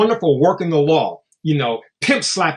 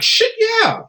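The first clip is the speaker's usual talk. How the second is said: imitating Meredith's reply; 3 dB louder than usual. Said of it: "She like".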